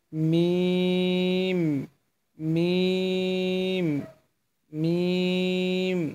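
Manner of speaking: মিম drawn out long; teacher modelling মিম slowly; slow letter demo. Shown as a demonstration. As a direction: stretch মিম slowly for learners. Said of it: "এই হরফটি".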